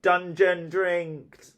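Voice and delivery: loud, high, drawn-out wail; outraged storybook cry; spluttered outrage. A narrator's reading. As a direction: wail it slowly after gagging. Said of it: "gagging and spluttering".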